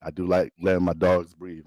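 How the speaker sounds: ordinary delivery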